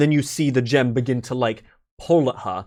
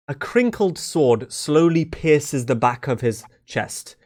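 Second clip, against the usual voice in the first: ordinary talk, nothing marked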